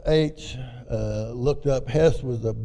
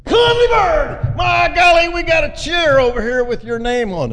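a big shouted welcome, much higher and louder; loud greeting; 8 dB louder than usual. Retold or imitated. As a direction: call out across the room to an old friend. Old buddy greets him loudly.

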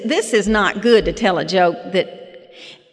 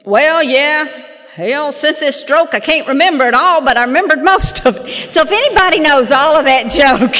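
loud, higher-pitched father impression; hammed-up loud old storyteller; imitating her dad; 6 semitones higher, 7 dB louder than usual. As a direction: belt it out as dad delivering the punchline. Acting out father's punchline.

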